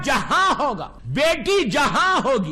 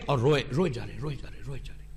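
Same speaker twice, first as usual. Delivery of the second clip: hushed grieving narration; 8 dB quieter, much faster than usual. A narrator's reading. Telling of the Prophet weeping.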